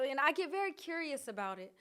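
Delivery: ordinary talk, nothing marked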